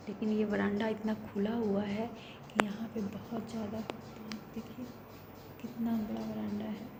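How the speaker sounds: soft, near-whispered vlogging; real hushed talk beside sleeping family; hushed late-night voice. Real, not acted. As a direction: keep it down so nobody wakes. Late night, family asleep nearby.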